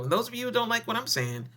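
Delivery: ordinary talk, nothing marked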